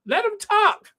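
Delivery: high, loud yell; exasperated shout. Retold or imitated. Viewer yelling at Lorraine to let the guest talk. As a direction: shriek it at the TV. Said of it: "I'm just like".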